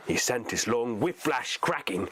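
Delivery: usual speaking voice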